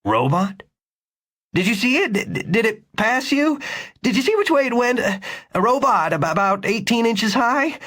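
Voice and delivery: so eager the words stumble; stammering urgency. A narrator's reading. Victor stammers urgent questions.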